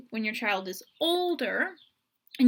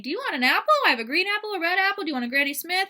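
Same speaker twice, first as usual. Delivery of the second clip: overcomplex parent talk; 6 semitones higher, much faster than usual. Shown as a demonstration. Parent bombarding a child with apple choices. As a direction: pile on the options eagerly. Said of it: "way too complex".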